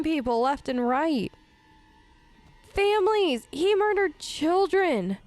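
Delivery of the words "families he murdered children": high, emphatic indignation; outraged disbelief. Real, not acted. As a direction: hit each word, appalled by the news. Reacts to the letter about slaughter.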